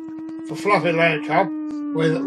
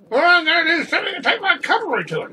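angry farmer; 10 semitones higher, 5 dB louder than usual. Retold or imitated. Farmer threatens the cat.

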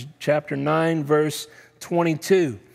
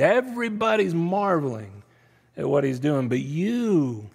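ordinary talk, nothing marked

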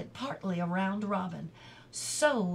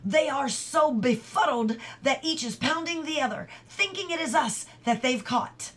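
raised, louder, urgent voice; pressing and excited, higher than usual; urging; 6 semitones higher, 5 dB louder than usual. A narrator's reading. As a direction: urge companions on in excitement. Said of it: "urged Robin".

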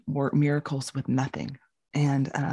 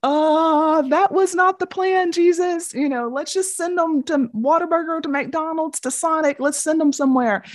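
higher, fussing disciple pleading with Jesus; mock panic, trying to wriggle out of the job; exasperated disciple; 12 semitones higher, 3 dB louder than usual. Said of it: "I'd be like".

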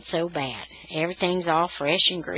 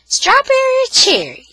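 high, loud vendor's question; snow cone seller; 10 dB louder than usual. A narrator's reading. Snowball lady offering flavours to a dithering child, calling out. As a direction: call the choices out high and loud.

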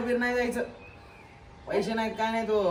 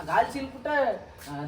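usual speaking voice

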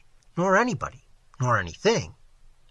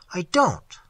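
higher, louder denial; cried out; 5 semitones higher, 5 dB louder than usual. A narrator's reading. Narrator denying he shudders, crying out. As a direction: protest with a sudden cry. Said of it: "I cried".

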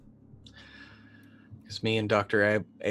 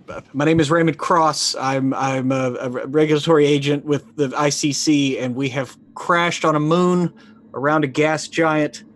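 louder, urgent radio plea for rescue; distress call; 2 semitones higher, 4 dB louder than usual. Acted. Stranded agent pleading over the radio, urgent and loud. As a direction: beg for help over the comm.

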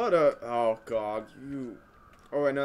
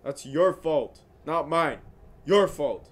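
ordinary talk, nothing marked

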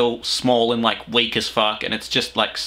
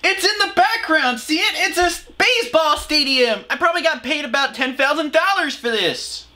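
put-on much higher, over-the-top cheery kids' host parody; mock kids' host voice; 13 semitones higher than usual. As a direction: ham up a fake-bubbly children's presenter. Mocking the children's host.

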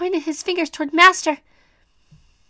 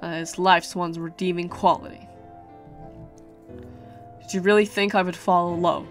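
quiet gloating villain; 3 semitones lower, 4 dB quieter than usual. Acted.

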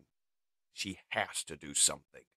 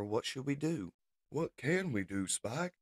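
higher-pitched pleading questions; worried robot dialogue; 6 semitones higher than usual. Acted. Spike and Beachcomber wonder what to do, worried. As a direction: ask it higher, unsure what to do.

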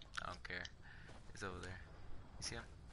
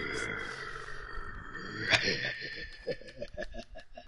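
drawn-out low laugh; long laugh; 11 semitones lower, 5 dB quieter than usual. Real, not acted. Laughs after surviving.

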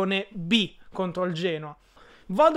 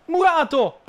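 shouting; 8 semitones higher, 8 dB louder, slower than usual. Real, not acted. Shouting at a block.

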